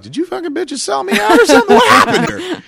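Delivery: high, loud, hammed-up indignant yell; outraged accusation. Passenger turns on his seatmates, comic outraged yell. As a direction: explode at them in a comic screech. Mock outburst at fellow passengers.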